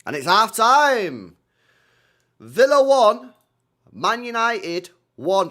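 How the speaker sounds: loud, slow half-time score call; mock announcer. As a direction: boom it out like a stadium announcer.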